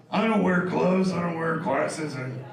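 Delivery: lightly voiced gruff worker; a hint of a tough job-site guy; roughneck. A rough worker brushes off safety gear, lightly mocked. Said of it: "the guy who comes home from the job site".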